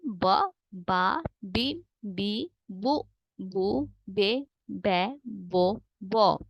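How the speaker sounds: slow, drawn-out syllable recital; slow teacher drill. Shown as a demonstration. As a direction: sound out each syllable slowly for the class. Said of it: "हम slow बोलते हैं".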